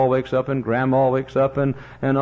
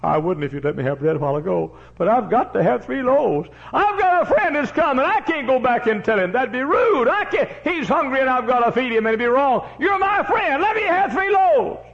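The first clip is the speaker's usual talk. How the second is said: high, loud urgent begging; very high put-on voice of a desperate neighbour; pleading friend; 14 semitones higher, 4 dB louder than usual. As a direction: beg him at the door, fast and high.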